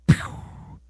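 sudden airy "pew" sound effect; quick blown noise for sudden movement; imitated whoosh. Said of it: "that arm went".

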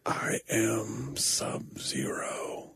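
slow, drawn-out hushed growl of a whisper; whispery Sub-Zero. Retold or imitated. Quoting a movie villain naming himself, slow whisper. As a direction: whisper it very slowly, self-announcing. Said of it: "and he goes".

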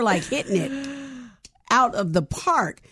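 ordinary talk

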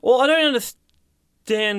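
slow, high, whiny; high whiny complaint. Acted. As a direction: stretch it out, complaining. Arguing about paying.

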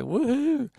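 mock-excited little whoop; playful woohoo. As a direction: let out a light, high-pitched woo-hoo. Mimics excitement at coffee coming.

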